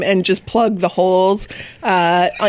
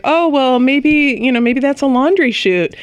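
higher, put-on hopeful voice; trying to be upbeat; 5 semitones higher than usual. Greg looking at the terrible resort toilet, trying to be upbeat. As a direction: imitate someone keeping it upbeat. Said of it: "trying to be upbeat".

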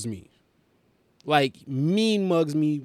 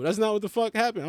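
ordinary talk, nothing marked

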